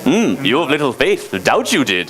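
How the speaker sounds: a hint of the little green master; Yoda. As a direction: hum first, then invert the words like a tiny sage. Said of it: "in the voice of Yoda".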